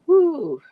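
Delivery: high, loud whoop; excited woo. Real, not acted. Reader reacting to a card she pulled, excited woo. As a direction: let out a sudden high "woo".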